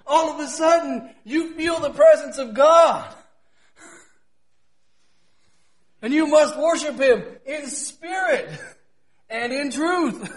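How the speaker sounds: ringing pulpit proclamation; impassioned preaching. Real, not acted. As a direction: preach it high with drawn-out emphasis. Preaches at full force.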